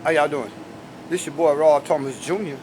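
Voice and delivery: ordinary talk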